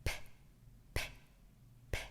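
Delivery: repeated p, each a quick burst from closed lips; popped p sounds. Shown as a demonstration. Teacher demonstrating lip pops to children, quick bursts. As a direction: pop it, pop it, pop it. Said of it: "making the pop sound".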